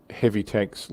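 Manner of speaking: ordinary talk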